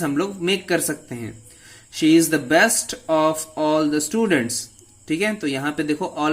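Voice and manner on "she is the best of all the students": slow example read. Shown as a demonstration. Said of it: "teacher reads example sentence".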